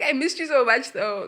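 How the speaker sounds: high-pitched, hammed-up longing; mock tearful pining. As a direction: whine it like a lovesick ex, playfully. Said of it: "cry, but, you know, like".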